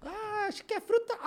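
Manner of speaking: high mock clerk, unsure guess. Retelling the clerk guessing the sweet's flavour, high mock voice. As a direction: pitch it up like a clueless clerk guessing.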